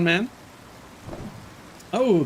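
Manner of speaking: ordinary delivery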